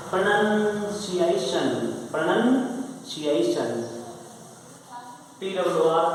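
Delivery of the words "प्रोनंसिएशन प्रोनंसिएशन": slow model word. Shown as a demonstration. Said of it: "P R O N O यू N C I A T I O N".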